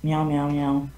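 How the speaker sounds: low, quiet meow imitation. Teasing friends with meows, low deadpan meowing. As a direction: meow softly like a deadpan cat. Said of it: "Why are you meowing?".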